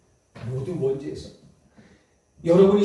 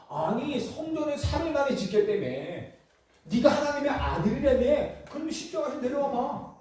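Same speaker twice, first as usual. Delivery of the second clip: much higher, needling taunt; mocking; 9 semitones higher than usual. Preacher acts out the crowd daring Jesus to come down, mocking. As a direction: egg him on in a raised, jeering pitch. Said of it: "막 이렇게 조롱하는 거야".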